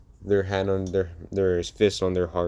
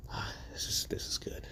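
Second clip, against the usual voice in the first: breathy satisfied whisper under the breath; pleased whisper; 13 dB quieter than usual. Acted. Izuku whispering to himself over his clean room. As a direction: whisper it like admiring a spotless room.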